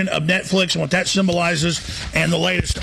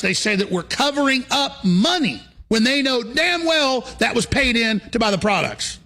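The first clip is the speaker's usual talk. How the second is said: ranting; 6 semitones higher than usual. Real, not acted. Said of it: "I'm gonna stop ranting".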